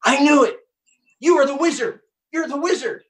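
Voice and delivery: put-on excited kid. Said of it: "And he goes".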